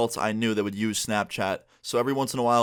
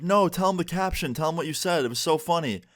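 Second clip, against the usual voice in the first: higher-pitched imitation of an eager mom; urging mom; 6 semitones higher than usual. Girlfriend's mom wants the caption told at dinner, urging. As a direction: coax him as the mom, pitched up.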